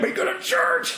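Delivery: very high mock Southern voice; squeaky exaggerated Southern church lady; Southern accent. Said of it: "a southern accent".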